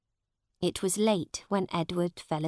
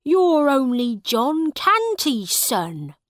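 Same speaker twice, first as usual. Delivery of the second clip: scoffing; 7 semitones higher, 8 dB louder, slower than usual. A narrator's reading. Farmer scoffing at the boy.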